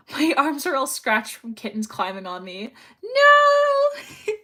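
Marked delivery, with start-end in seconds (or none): 3.0-4.3 shocked no. Real, not acted. Reacting to kitten scratches.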